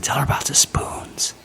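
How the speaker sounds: whispered prompt. Real, not acted. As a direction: whisper it so mom won't hear. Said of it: "whispering to daughter".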